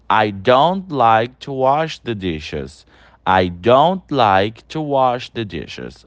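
slow, careful read of "don't" sentence; slow model sentence. Shown as a demonstration. Teacher modelling the contraction to students, slow and careful. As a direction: spell it out slowly for the class. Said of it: "Então".

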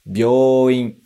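"Byoin" with long o, byo-u-in; teacher models "Byoin" slowly, vowel held long; Japanese byouin. Shown as a demonstration. Teacher modelling hospital word: "Byoin" with long o. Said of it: "Mais uma vez".

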